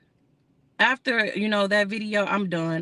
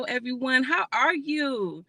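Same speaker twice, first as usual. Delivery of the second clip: ordinary talk, nothing marked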